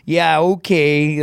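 slow, drawn-out sarcastic agreement; dismissive mom. Retold or imitated. Mom reacting to son's bold claim, mockingly dismissive. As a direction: scoff and stretch the okay.

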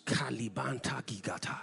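low whispered prayer in tongues. Real, not acted. Preacher asking people to pray in tongues, whispering. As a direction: pray it under your breath, whispering. Praying in tongues mid-sermon.

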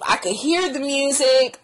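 ordinary delivery

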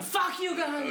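high pretend heckle at a crowd; mock angry yell. Friend imagining dad throwing drink at crowd, angry yell. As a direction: imitate an enraged fan.

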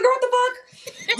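high, loud, drawn-out squeal through laughter; laughing mock shout. Mom reacting to the podcast joke, laughing shout. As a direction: belt it out like mom cracking up. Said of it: "And she was like".

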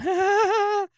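high, loud taunting ha-ha; mocking laugh. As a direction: cackle it at him to needle him. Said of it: "just laughed, went".

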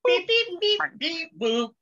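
high droid beeping, first beep loudest. Retold or imitated. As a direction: chirp it like a little robot. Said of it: "I thought R two D two was visiting you".

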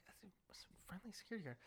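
quick, whispered mock aside; hushed aside. Whispering to sister while passing the guard, hushed aside. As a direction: whisper it fast under the breath.

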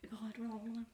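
whispered echo. Pretend. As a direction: whisper the word over and over. Echoing friend's word in whisper.

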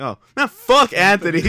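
high, loud comic curse; retold loud outburst. Partygoer cursing the absent guest, comic outburst.

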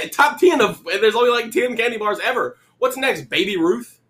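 high-pitched, laughing disbelief; incredulous high squeal of protest; mock incredulous. Real, not acted. Scoffing at friend's candy ranking.

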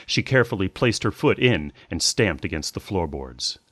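ordinary delivery

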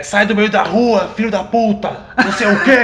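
slow furious yell at a pedestrian; angry yelling. Retold or imitated. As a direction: bark it out like an angry driver. Voicing driver cursing at him.

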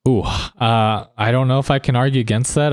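ordinary talk, nothing marked

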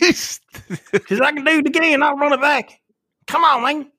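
high, put-on voice; eager offer. Offering to do the impression again, high put-on voice. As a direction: hype yourself up to perform. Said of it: "You want me to do it again?".

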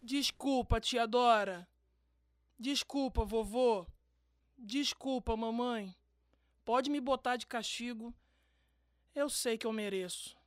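ashamed. Acted. Boy apologizes ashamed.

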